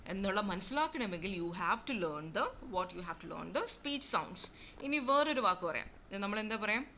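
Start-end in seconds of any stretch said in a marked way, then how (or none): none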